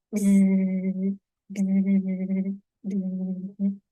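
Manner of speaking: low buzzing like a bee; bee buzz imitation. Shown as a demonstration. Imitating a bee buzzing for the guessing game. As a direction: buzz it for the kids. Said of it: "Suaranya begini".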